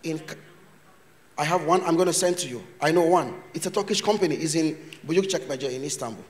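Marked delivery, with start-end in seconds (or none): none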